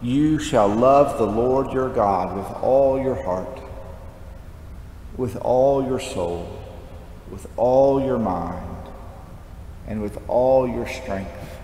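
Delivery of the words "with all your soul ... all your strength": ordinary talk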